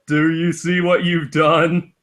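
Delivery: ordinary talk, nothing marked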